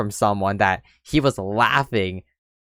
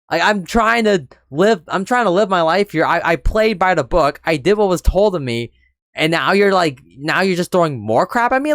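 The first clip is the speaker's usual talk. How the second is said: real exasperated rant; 6 semitones higher than usual. Real, not acted. Ranting about the man who keeps shifting the goalpost, exasperated. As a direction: let the frustration boil over.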